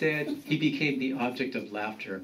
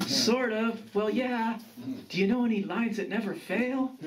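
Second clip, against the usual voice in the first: higher-pitched hopeful grandson; eager grandson; 11 semitones higher than usual. Acted.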